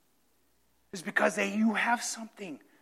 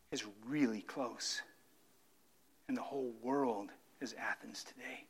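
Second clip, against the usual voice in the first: much softer, lower, with a rising tune on world; hushed repetition; 7 semitones lower, 14 dB quieter than usual. Acted. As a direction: say it again under your breath, a solemn echo. Same line repeated softly.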